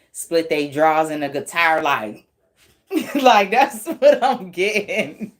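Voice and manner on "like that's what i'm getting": higher, slow words through real laughter; laughing. Real, not acted. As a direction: talk while cracking up. Cracking up at own image.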